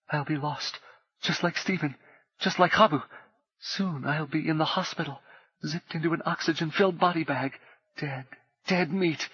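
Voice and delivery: high, trembling panic rising; panicked dread. A narrator's reading. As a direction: voice climbing in terror. Panic spiralling toward death.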